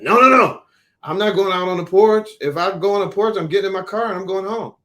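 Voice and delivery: loud, high, indignant father; the father digging in his heels, raising his voice; stubborn angry refusal. Retold or imitated. The father refusing to take his cigarette outside, loud and indignant.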